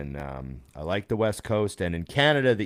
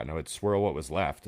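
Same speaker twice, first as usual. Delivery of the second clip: ordinary delivery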